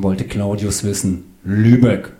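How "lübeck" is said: ordinary delivery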